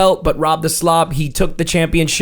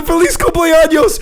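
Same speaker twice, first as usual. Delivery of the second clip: excited; 13 semitones higher, 4 dB louder than usual. Retold or imitated. Retelling the move.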